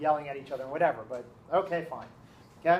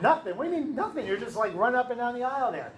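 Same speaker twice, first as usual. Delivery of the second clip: higher-pitched imitation of disbelief; incredulous classmate; 6 semitones higher than usual. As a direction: question it as if baffled. Classmate incredulously questions her excuse.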